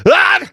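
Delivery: sudden high yelp of fright; mock scream. Kid cut by glass, squealing in fright.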